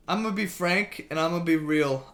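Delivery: normal talking voice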